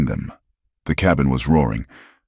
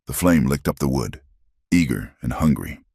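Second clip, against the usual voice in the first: plain reading voice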